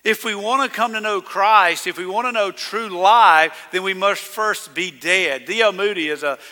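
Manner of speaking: usual speaking voice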